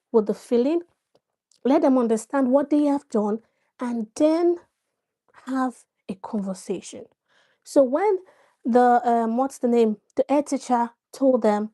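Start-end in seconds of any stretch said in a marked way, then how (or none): 5.5-7.1 soft whispered ending; hushed and voiceless. Real, not acted. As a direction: drop to a whisper to land the point. Drops to a whisper at the end of a point.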